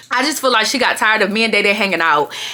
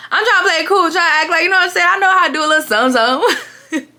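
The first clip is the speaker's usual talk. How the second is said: higher-pitched mock bravado; playing it cool; 9 semitones higher than usual. First-time fighter bluffing, playing it cool. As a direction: pretend you can handle a fight.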